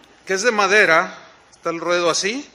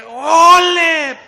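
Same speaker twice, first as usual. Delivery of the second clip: loud ole; 9 semitones higher, 10 dB louder than usual. Retold or imitated. Crowd cheering the torero.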